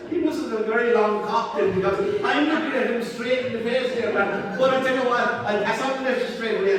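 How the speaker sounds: high, loud comic retort; hammed-up, high-pitched phone reply; loud punchline reply. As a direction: raise pitch and volume for the punchline.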